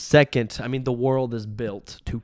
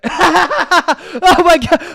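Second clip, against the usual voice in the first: high, loud hooting cackle; imitating the family's weird laugh; mock ugly laughter; 14 dB louder, much slower than usual. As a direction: cackle like a whole room losing it. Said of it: "That's basically what all five people did".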